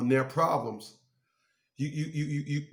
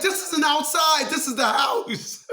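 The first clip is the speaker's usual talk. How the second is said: high, louder, slow laughing scold; 11 semitones higher, 4 dB louder than usual. Retold or imitated. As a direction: scold the dog while cracking up.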